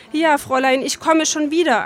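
plain reading voice

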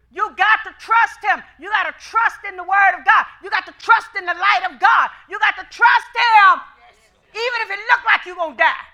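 loud, driving preacher's cry; preaching at full force. Acted. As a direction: hammer the congregation with it.

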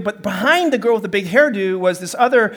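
usual speaking voice

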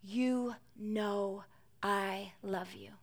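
rising then sinking to a pained hush; wounded, softening to a breath; hurt plea. A narrator's reading. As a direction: answer hurt, fading into a near whisper. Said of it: "Peter was hurt".

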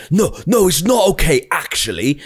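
high mock shout of outrage; retold woman telling him off, in a hammed-up high yell; mock furious woman. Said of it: "She's fucking losing it".

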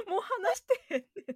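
high, loud, half laughing half crying; laughing protest. Real, not acted. Insisting they weren't talking about her, laughing exasperation.

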